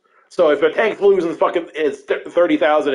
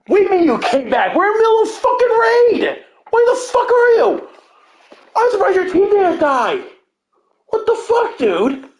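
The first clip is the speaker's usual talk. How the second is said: high-pitched retold outburst at a teammate; exasperated scolding. Raider to returning AFK cleric mid-raid, exasperated scolding. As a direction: berate a missing raider in a strained, high voice.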